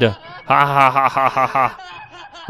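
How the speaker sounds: slow, evenly spaced "ha" cackle; acted villain laugh. Villain mocking the frightened hero, deliberate evil laugh. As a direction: read out a villain's laugh, beat by beat.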